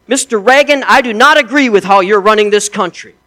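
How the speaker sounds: mock-proud soldier in a retold joke; boastful American soldier. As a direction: crow about freedom to the Russian.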